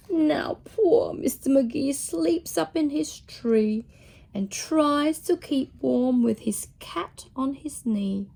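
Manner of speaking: ordinary delivery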